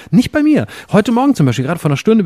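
high, eager outburst; excited. Real, not acted. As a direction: burst out with delight. Excited contrast about his feed.

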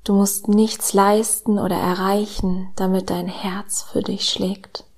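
slow, soft meditation voice with whispered lines; calm guided meditation, hushed and slow; soft spa whisper. Acted.

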